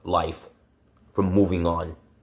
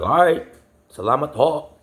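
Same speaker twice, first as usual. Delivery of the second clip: high, loud mock-polite goodbye; sarcastic farewell; 8 semitones higher, 4 dB louder than usual. Sarcastic thanks to the uncle who refuses a ride. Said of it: "I was like".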